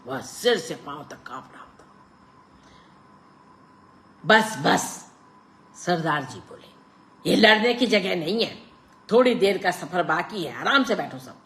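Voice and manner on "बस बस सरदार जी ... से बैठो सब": louder, calming elder; firm Sardar. A narrator's reading. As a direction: settle them down firmly. Sardar stops the quarrel.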